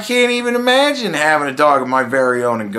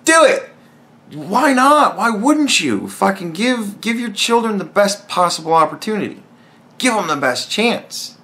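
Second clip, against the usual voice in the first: mock heated urging; retold shout; 7 semitones higher than usual. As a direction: push them hard to do it. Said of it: "'Cause I was like".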